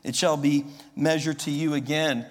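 loud, ringing scripture reading; preaching scripture. Acted. Preacher booming scripture to his congregation. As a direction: proclaim the verses to the whole hall. Reads scripture at full force.

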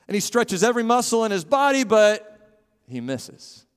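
dramatic building. Acted. Preacher dramatizes football miss.

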